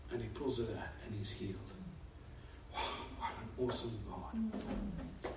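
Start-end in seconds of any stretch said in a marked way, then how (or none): 2.7-5.3 hushed awe. Real, not acted. Awed praise after healing.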